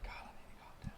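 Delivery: hushed, whispered self-talk; self-calming whisper. Retold or imitated. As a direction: whisper it to yourself, trying to cool off. Acts out calming himself while raging.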